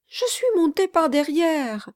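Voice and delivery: high, breathless reassurance; panting young girl. Acted. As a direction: still out of breath from running. Marquise voiced.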